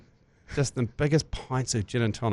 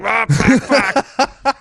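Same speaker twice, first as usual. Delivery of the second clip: higher, louder mock dismay from Jerry; caught-out swearing; 11 semitones higher, 5 dB louder, much slower than usual. Jerry caught by the parents, hammed-up higher dismay. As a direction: play it like a man caught in his Speedos. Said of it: "He's like".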